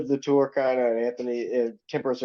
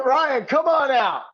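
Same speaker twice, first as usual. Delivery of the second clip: high, stretched call to the wings; mock announcer; 11 semitones higher, slower than usual. Bandmate calling a guest onstage, hammed-up announcer. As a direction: summon the guest like a TV host.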